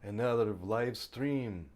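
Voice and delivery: a mock Finnish accent, played for laughs; ridiculous foreign accent. Streamer greets viewers in a silly put-on Eastern European accent. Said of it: "why am I speaking in this ridiculous accent".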